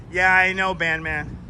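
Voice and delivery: usual speaking voice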